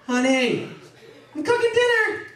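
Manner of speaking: high sing-out to someone in another room; high-pitched domestic call; calling out at home. Acted. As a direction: imitate a spouse calling through the house. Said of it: "You gotta be like".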